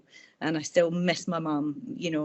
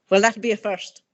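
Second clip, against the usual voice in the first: a hint of a wry older Irish woman, higher and a bit louder; lightly voiced mother-in-law's teasing jab; dry Irish quip; 4 semitones higher, 6 dB louder than usual. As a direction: toss off a teasing Irish put-down. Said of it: "like that".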